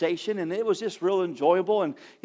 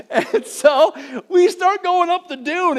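much higher and louder, slower, with a laugh; excited; 11 semitones higher, 8 dB louder than usual. Real, not acted. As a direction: build the thrill of the climb. Climax of dune climb.

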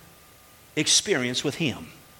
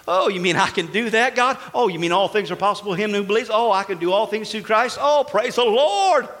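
excited discovery; 5 semitones higher, 4 dB louder than usual. Pretend. Acting out a believer's discovery.

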